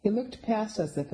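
ordinary delivery

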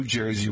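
"Jersey" as JOY-zee; mock local pronunciation. Shown as a demonstration. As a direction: put an "oy" in "Jersey". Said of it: "would ever pronounce".

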